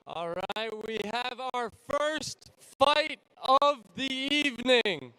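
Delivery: booming fight introductions; ring announcer. Acted. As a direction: announce them to the arena.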